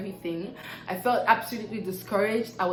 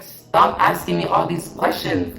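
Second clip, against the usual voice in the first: loud mock-exasperated burst; exasperated outburst; 3 semitones lower, 8 dB louder than usual. Tired passenger fed up with boss's questions, exasperated outburst. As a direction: act out snapping under a barrage, loud.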